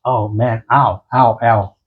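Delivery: imitating an ache while getting up off the floor; a hint of an older person in pain; mock stiff-knee groans. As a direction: groan lightly like sore old knees. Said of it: "you're gonna do this".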